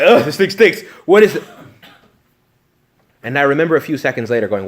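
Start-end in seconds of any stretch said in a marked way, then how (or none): 0.0-1.4 loud, high, disgusted groan at the smell; disgusted crowd. Retold or imitated. Priest mimics tourists complaining about the stench, loud and disgusted. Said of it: "crowd of people who was like".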